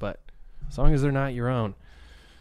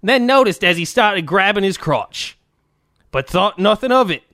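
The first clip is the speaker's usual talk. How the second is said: mock Australian accent; 4 semitones higher, 4 dB louder than usual. Reading an Australian fan's letter, a strained mock accent. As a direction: push through a shaky Australian. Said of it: "trying so hard to not be as shitty".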